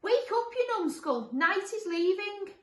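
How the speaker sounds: high, loud wake-up call; high-pitched irritated character yell; monster shouting. Acted. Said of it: "He shouted through the hole".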